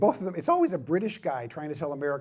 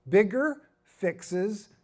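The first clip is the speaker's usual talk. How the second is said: slow and high, heavy spacing; emphatic key point; 5 semitones higher, much slower than usual. Real, not acted. Preacher repeating the lesson for the church, slow and emphatic. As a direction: hammer it home one word at a time.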